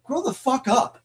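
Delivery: high-pitched, indignant put-down; exasperated scolding. Acted. Reader telling the teen character off, exasperated scolding. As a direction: snap it at the character, fed up.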